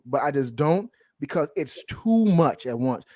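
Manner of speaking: normal talking voice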